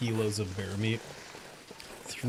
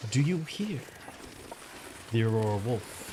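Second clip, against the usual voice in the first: spooky storytelling. Pretend.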